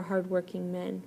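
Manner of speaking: usual speaking voice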